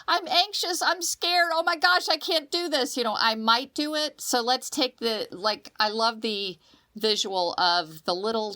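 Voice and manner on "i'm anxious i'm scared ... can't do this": higher pitched anxious mock cry; mock panic. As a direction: play the panicky one, lightly. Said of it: "I'm the screaming".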